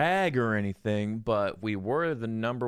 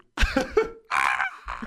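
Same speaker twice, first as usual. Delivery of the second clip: squealing laugh; high cackle; high shrieking laugh; 4 dB louder than usual. Real, not acted.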